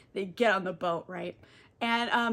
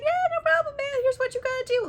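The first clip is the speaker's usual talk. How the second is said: much higher, laid-back dude voice; chill dude voice. Retold or imitated. Thief Tyrese to Odysseus, high laid-back dude voice. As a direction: play the easygoing thief, pitched way up. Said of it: "Tyrese is like".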